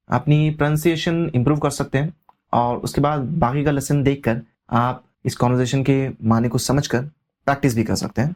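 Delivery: ordinary delivery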